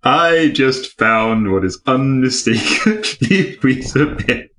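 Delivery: reading through giggles, voice rising; real laughing. Real, not acted. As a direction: crack up on the word Weetabix. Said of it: "can't say Weetabix without laughing".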